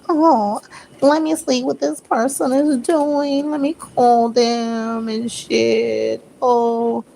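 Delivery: playfully imitating someone snooping on an ex; mock nosy curiosity. Reader mimics nosy curious listener.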